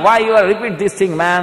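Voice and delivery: slow, loud American complaint; foreign English. Retold or imitated. American guest puzzled by the ritual, in slow English.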